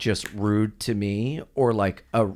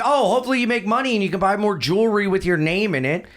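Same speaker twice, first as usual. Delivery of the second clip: fast, high-pitched mock rant; acted sarcastic outburst; 7 semitones higher, faster than usual. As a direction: rattle off a sarcastic wish, higher. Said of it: "really nice guy who explodes".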